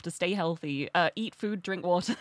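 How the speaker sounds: ordinary delivery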